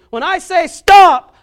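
slow, booming shout of the command; shouted stop. Acted. Father shouting stop to a running child, slow booming yell. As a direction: bellow it like stopping a child in traffic.

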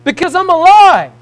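preaching shout. Acted. Preacher rejoicing before the congregation, high loud cry. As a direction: shout it out in triumph.

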